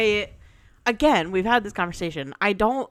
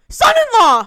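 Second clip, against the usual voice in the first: a high, much louder blurted shout; mock drunk yell; 13 dB louder than usual. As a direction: blurt it out like a tipsy friend. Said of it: "She goes".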